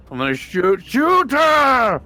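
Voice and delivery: building to a high, loud yell; excited battle shout. Pretend. Player declaring an attack on the monster, excited shout. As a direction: burst into a drawn-out shout on the last words.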